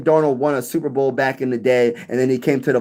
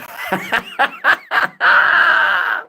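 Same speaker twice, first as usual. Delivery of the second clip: real laughing fit at a comment. Real, not acted. Viewer comment cracks him up.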